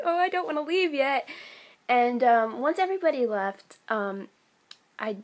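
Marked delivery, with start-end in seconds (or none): 0.0-1.3 high-pitched little whine; reluctant plea. Retold or imitated. Fan at the goodbye not wanting to leave, high plea.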